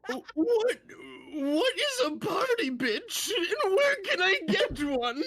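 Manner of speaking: put-on very high, cracking old man squeal; shrill quavering old codger; squeaky old man.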